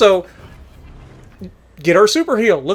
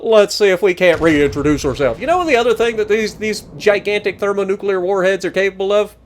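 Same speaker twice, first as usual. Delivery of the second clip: normal talking voice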